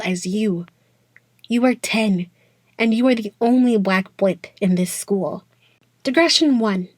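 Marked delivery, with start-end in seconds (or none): none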